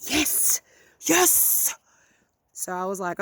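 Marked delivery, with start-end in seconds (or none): 0.1-1.7 high, triumphant yes; a burst of real relief and joy; excited cheer. Real, not acted. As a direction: cheer like he finally got it. Celebrating his right answer.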